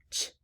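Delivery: Ch-R-L-E sounded out piece by piece; slow sounding out of Ch-R-L-E. Shown as a demonstration. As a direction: break Ch-R-L-E into its sounds. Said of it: "let's sound this word out".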